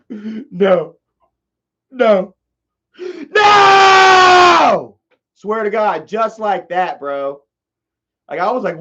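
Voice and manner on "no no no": two short sobbed no's building to a long, high wail; imitated crying outburst. Imitating Dad seeing his gift unveiled, tearful cry swelling to a wail. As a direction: imitate a tearful cry that erupts into a drawn-out shout. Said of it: "Dad was crying. He was like".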